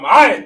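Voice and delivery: Ma'aitha barked out loud and short; emphatic command. Shown as a demonstration. Teacher demonstrates commanding kids with Ma'aitha, loud. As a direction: hit Ma'aitha hard like an order. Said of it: "Put some, some emphasis on that thing".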